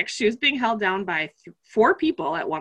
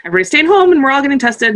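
loud, rushed, higher manic voice; fast, frantic mock panic; inner crazy person; 5 semitones higher, 8 dB louder, much faster than usual. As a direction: rattle it off like your unhinged inner self. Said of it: "the crazy person inside of me was like".

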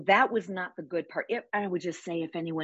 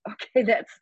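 laughing; 3 semitones higher, 4 dB louder than usual. Real, not acted. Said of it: "a really explicit way of saying".

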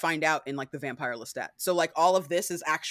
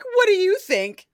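high, loud teasing retort; sly deflection; 13 semitones higher, 9 dB louder than usual. Retold or imitated. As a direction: toss the question back. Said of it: "He's like".

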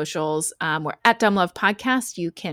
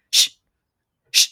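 short hard shush, twice; sharp shushing; 5 dB louder than usual. Retold or imitated. Parent cuts off son at bedtime, sharp shushing. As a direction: shush a chattering kid. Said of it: "started going like".

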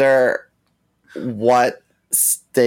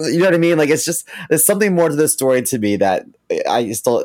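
ordinary talk

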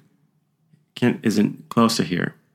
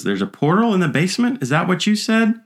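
higher, lightly voiced ghost hunter asking; imitating Kent; 9 semitones higher than usual. Kent questions the spirit box, lightly imitated higher. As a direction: raise the voice as a curious investigator.

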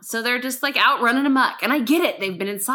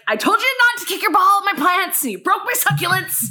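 raised, loud retold scolding; pissy; 8 semitones higher, 5 dB louder than usual. Neighbour scolding kids for hitting the plants, pissy. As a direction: lay into them, high and indignant. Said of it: "But I'm pissy".

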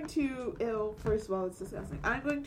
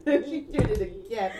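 slower, laughing through words; 6 semitones higher, 12 dB louder than usual. Real, not acted. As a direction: say it while cracking up.

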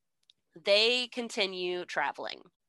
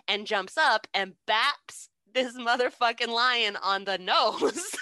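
ordinary talk, nothing marked

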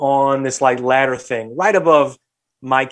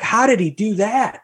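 lightly voiced kid, pitched up high; imitated curious kid; 8 semitones higher than usual. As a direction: ask it as a prying little kid. Voicing the nosy kid.

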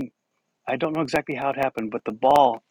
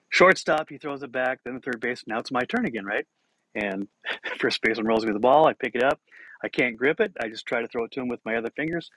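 usual speaking voice

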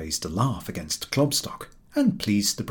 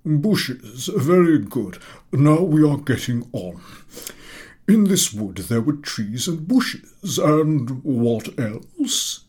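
louder, slow, high praise; pleased professor; 6 semitones higher, 6 dB louder, much slower than usual. A narrator's reading. Professor praising the answer and pushing on. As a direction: encourage onward.

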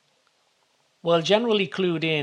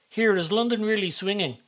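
high excited call out the window; excited shout; 7 semitones higher than usual. A narrator's reading. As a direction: call up to the man, thrilled and pitched high. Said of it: "shouts Connor excitedly".

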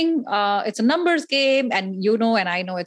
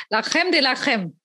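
put-on French accent; 3 semitones higher than usual. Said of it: "my inner French is coming out".

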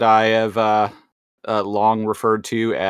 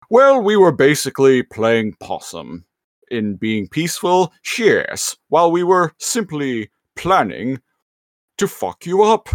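rising into a gleeful villain taunt; villain voice climbing higher to the punchline; gloating villain; 5 semitones higher than usual. Retold or imitated. Megatron villain reveal quoted.

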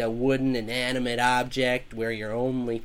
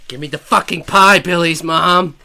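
higher, much louder furious demand; shouting; 6 semitones higher, 14 dB louder than usual. Acted. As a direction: bellow the demand at her. Demand repeated, escalating.

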